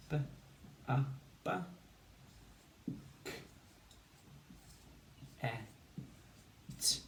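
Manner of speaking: bucket's sounds one at a time; bucket sounded out. Shown as a demonstration. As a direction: say bucket as isolated phonemes. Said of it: "Two syllables".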